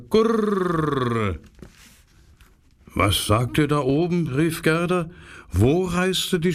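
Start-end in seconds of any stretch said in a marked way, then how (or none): 0.1-1.4 „gurr." cooed higher and drawn out, sinking; long falling pigeon coo. Acted. Wood pigeons closing their tale to Gerda, a long falling coo. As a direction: let the last „gurr." slide slowly down. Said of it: "Da sagten die Waldtauben".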